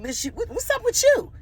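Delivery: very high, heated yell of a challenge; angry outburst. Retold or imitated. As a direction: fire it back ready to fight. Squaring up to the big aunt.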